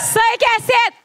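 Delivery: high, loud announcement to the crowd; stage shout. Pretend. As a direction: proclaim the show name to the fans. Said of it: "J'ai toujours rêvé de faire ça". (titre du show crié à la foule)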